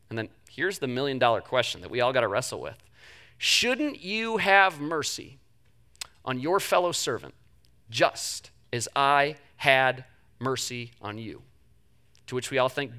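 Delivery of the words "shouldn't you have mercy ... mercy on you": slow, soft and lower; grave rebuke. A narrator's reading. King confronting the servant, slow soft rebuke. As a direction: let each word land slowly and heavily.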